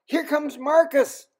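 high, loud call to a friend overhead; Henry calling up at the approaching eagle. A narrator's reading. Henry announces the eagle, a high loud call. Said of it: "But at last he called".

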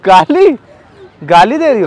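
indignant disbelief. Real, not acted. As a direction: throw it back loudly, affronted. Prankster reacts to being sworn at. (हैरानी भरी ऊँची आवाज़ में सवाल)

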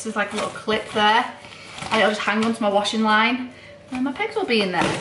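ordinary talk, nothing marked

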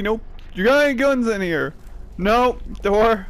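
ordinary talk, nothing marked

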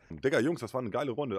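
normal talking voice

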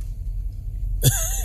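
airy laugh up high; breathy giggle. Real, not acted. Kid's answer amuses him.

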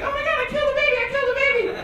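Sandra Bullock swerving the bus, mock shriek; panicked Sandra Bullock. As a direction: squeal it like a frantic driver. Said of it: "she's like".

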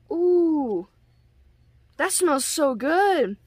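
high, slow delighted ooh; real pleasure at a lovely scent; delighted sniff. Real, not acted. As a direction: savour the smell, drawn out. Sniffing the scented serum mask.

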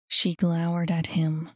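plain reading voice

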